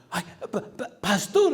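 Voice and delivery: high, breathy-free startled gasp of pastor, half laughing; startled stammer. Retold or imitated. Man caught by his pastor, stammering in surprise.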